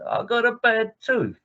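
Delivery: a mild Cockney whine about a bad tooth; Cockney Joe complaining. Teller voices Cockney Joe moaning to Harry, in a high Cockney whine. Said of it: "two Cockney chaps talking".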